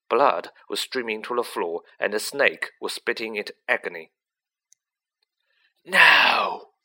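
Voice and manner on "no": loud scream of protest; loud, drawn-out shout; Riddle screaming. A narrator's reading. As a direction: scream it out in one long falling cry. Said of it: "Harry heard Riddle screaming".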